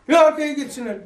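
high, loud angry demand; angry imam insisting. Retold or imitated. Imam arguing with preacher about children, angry shout. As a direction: insist loudly they move back. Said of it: "dedim çocuğa".

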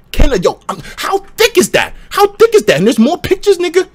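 can't believe what he's seeing; excited disbelief. Real, not acted. Streamer gawking at the game preview in excited disbelief. Reacting to game character art.

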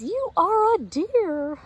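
high, loud delighted mother hen; Mama Hen delighted. A narrator's reading. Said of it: "she says".

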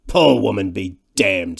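brusque, forceful dismissal; overbearing big man's retort; loud-voiced bully. A narrator's reading. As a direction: cut him off rudely. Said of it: "his massive and loud-voiced companion".

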